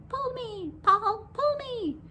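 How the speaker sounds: high, squeaky tiny creature voice; drawn-out, high-pitched coaxing from a little pigtail. A narrator's reading. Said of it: "a little voice coming from the pigtail".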